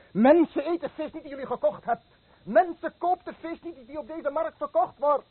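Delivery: high, loud cry across the market; loud public cry against his own fish; shouted warning. Acted. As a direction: warn the whole market at the top of your voice. Fisherman cries warning to market.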